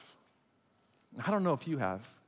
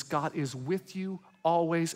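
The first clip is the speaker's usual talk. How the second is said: ordinary delivery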